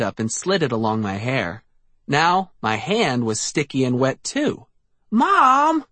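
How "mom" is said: high loud call for Mom; crying out. A narrator's reading. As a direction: cry out in panic. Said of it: "I cried".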